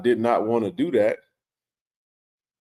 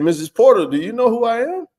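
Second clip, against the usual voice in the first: excited call; 11 semitones higher, 5 dB louder than usual. Retold or imitated. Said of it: "And I said".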